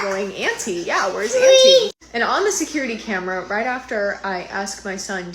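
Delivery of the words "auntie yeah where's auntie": put-on high, louder, slow little-boy voice calling for Auntie; imitating her son. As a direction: voice the toddler asking eagerly for his aunt. Son voiced on camera recording.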